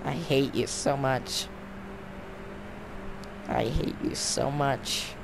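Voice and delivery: a slow mock demon snarling hate; lightly voiced mock demon. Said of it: "Stop with the demon voice".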